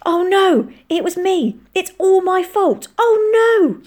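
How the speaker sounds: high, loud cry of guilt; panicked, high-pitched lament; horrified gasping. A narrator's reading. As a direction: cry out in horror. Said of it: "Frank gasped".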